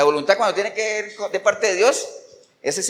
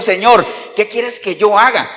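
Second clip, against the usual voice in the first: normal talking voice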